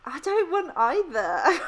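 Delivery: high, loud refusal of both options; laughing protest. Real, not acted. Balks at weird would-you-rather question.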